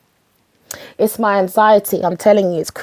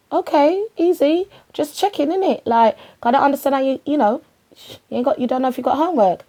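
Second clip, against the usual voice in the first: higher, fast, breezy mum voice; mock mum; 4 semitones higher, faster than usual. Mum quizzing her son, higher and fast. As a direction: brush it off then rattle on quickly.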